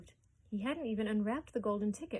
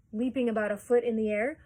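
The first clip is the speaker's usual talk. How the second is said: usual speaking voice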